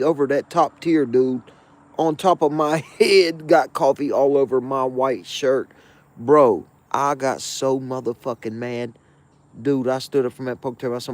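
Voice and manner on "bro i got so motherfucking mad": ordinary talk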